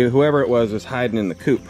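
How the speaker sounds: ordinary talk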